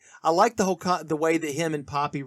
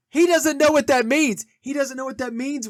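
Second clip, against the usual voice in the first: much higher and louder, bursting out; excited outburst; 9 semitones higher, 8 dB louder than usual. Real, not acted. Film fan delighting that Tony misses the insult, excited outburst. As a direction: blurt it out, thrilled by the joke.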